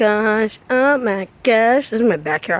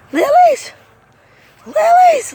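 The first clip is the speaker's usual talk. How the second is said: very high, loud squeal of delight; excited squeal; 5 dB louder, slower than usual. Real, not acted. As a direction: squeal the name with glee. Excited garden tour exclamation.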